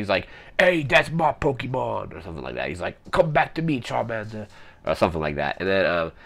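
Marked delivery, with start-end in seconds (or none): 0.6-2.2 high, slow mock British punk; punk British accent. Former trainer demanding his Pokemon back, punk British accent. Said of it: "punk British accent". 3.1-4.5 high-pitched mock British punk, dropping away at the end; punk British accent. Former trainer calling Charmander back, punk British accent. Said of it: "punk British accent".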